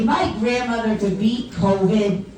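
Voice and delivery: ordinary talk, nothing marked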